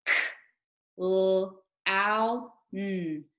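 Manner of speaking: each sound said separately: L, ow, r. Shown as a demonstration. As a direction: sound out L, ow, r one by one, like a teacher. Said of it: "Let's say the sounds to read the word".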